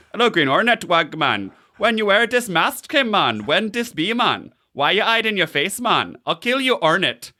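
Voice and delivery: high-pitched mock Jamaican accent, hammed-up; Jamaican accent impression. Podcaster imitating Cage's Jamaican villain pitch. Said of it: "Cage in a Jamaican-sounding accent".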